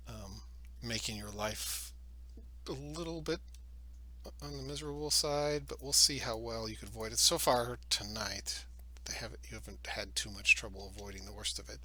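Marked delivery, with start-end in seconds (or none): none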